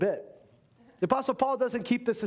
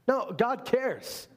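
ordinary talk, nothing marked

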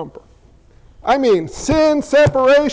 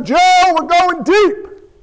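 high, slow, stretched-out call; acted holler to the crew; boss yelling out; 6 semitones higher, slower than usual. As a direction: call out orders like a boat boss. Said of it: "his boss yells out".

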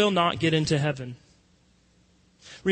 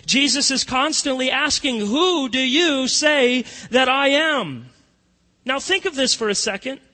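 preaching; 6 semitones higher, 5 dB louder than usual. Acted. Rising preaching climax.

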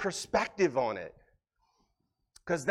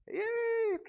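much higher, mock cheerful squeal; mock a shallow happy-clappy believer; fake cheery yay. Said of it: "Leave It to Beaver Christianity".

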